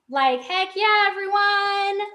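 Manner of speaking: high, drawn-out cheer to the crowd; cheerleading everyone into applause; rallying cheer. Real, not acted. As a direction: whoop up a round of applause, stretching the last word.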